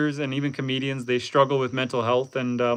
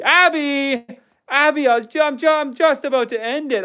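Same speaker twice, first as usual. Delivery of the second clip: high delighted calling out; excited greeting; 9 semitones higher than usual. Real, not acted. Excited greeting of returning friend.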